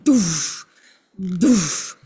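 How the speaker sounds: stamp it down into the ground; heavy pushing grunts. Retold or imitated. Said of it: "pushing them into the ground".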